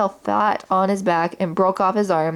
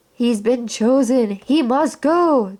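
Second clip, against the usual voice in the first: slow, higher, otherworldly alien chant; awed alien toys; 4 semitones higher, slower than usual. Acted. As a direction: intone it like a little alien in reverence.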